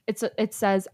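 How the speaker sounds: usual speaking voice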